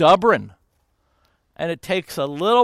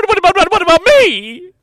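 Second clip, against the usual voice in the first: loud, high, sputtering mock protest; selfish whining; 11 semitones higher, 8 dB louder than usual. Selfish husband to his wife, loud high mock whine. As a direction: stammer into a hammed-up wail on ME. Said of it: "I can be all Mister Selfish".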